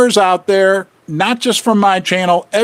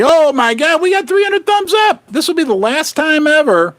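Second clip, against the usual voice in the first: much higher, thrilled and delighted; excited outburst; 10 semitones higher than usual. Real, not acted. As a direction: burst out with real glee at the milestone. Thrilled at hitting three hundred likes.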